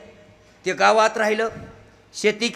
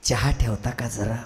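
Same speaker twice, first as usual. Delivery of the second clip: lower, slow timid asking; meek polite request; 8 semitones lower than usual. Shown as a demonstration. City husband timidly asking wife for tea, meek and slow. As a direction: ask gently, almost apologetic. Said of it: "त्यानं कसं सांगलं होतं".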